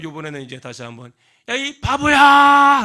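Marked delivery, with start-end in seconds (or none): 1.5-2.8 high, slow, much louder yell; angry yell. Acted. Upset girl screaming insults from the mountaintop, furious. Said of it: "열받았잖아".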